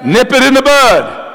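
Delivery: rally the room to say it back; call to repeat. Real, not acted. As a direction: lead the congregation in the line. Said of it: "How many say it with me?".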